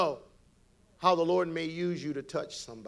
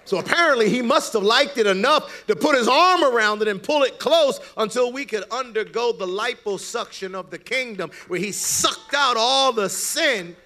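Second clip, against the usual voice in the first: higher, louder preacher's climb; full-force preaching; 8 semitones higher, 7 dB louder than usual. Acted. Preacher driving the point home to the congregation, fervent. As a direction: lift it to the rafters.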